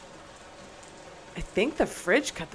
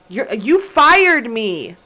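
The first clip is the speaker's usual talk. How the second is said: slow, much louder disbelief; loud indignant protest; 3 semitones higher, 12 dB louder, much slower than usual. Real, not acted. Fired worker answering the boss, loud indignation. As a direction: throw it back loudly, stretching the words. Arguing back at game boss.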